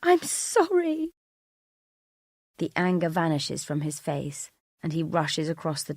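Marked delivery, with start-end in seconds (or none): 0.0-1.1 high, slow, sobbed apology; crying. Acted. Wife repeating her apology as sobs overtake her, crying. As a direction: wail it out, drawn out. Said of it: "I cry".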